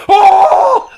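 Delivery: loud high "oh!" with laughter in it; delighted shout. Retold or imitated. Friend thrilled by bodyguard fight idea, delighted shout. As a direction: holler a long, high, thrilled "oh".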